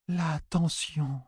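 high, hushed, very slow voice; strained oracle woman. A narrator's reading. One woman to the others, slow hushed oracle. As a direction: stretch it out high and soft.

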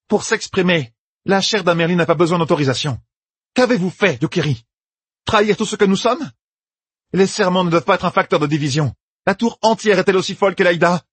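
high, forceful indignant voice; commanding Amyrlin. Acted. Egwene as Amyrlin rebuking the sitters, forceful. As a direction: confront them with authority.

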